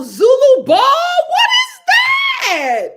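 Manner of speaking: very high, loud squeal of surprise; amazed shout. Real, not acted. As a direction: shriek the question in excited astonishment. Amazed reaction to unknown event.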